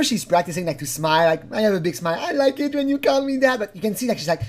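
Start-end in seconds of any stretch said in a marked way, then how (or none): 1.5-3.6 higher, put-on bright smiley voice; mock forced sweetness; forced cheer. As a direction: fake a big smile in the voice. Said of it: "It sounds a bit forced".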